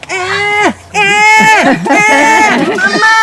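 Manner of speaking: loud, high imitation baby wail; screaming hungry baby. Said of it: "screaming exactly like a hungry baby".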